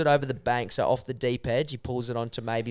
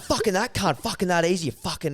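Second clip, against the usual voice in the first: higher, sputtered mock swearing; abusive ranting; 6 semitones higher than usual. Mate with the fish abusing him, imitated ranting. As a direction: imitate a mate cursing at you. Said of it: "he's abusing me".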